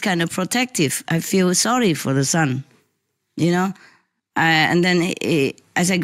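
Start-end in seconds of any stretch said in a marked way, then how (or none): none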